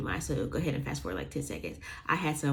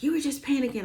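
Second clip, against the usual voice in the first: higher, mock-dismissive mom voice; mom dismissing; 10 semitones higher, faster than usual. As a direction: brush her off like a mom. Mother dismissing her.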